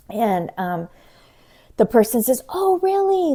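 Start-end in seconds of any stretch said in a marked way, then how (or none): 2.5-3.3 high, loud "Oh, really?"; hammed-up scammer intrigue; mock surprise. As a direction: feign being impressed. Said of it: "I'm giving their text some inflection".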